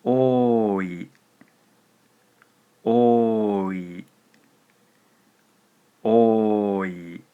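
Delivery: 多い said slowly and clearly; 多い carefully enunciated; clear listening prompt. Shown as a demonstration. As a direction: say 多い for a dictation. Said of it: "Listen carefully".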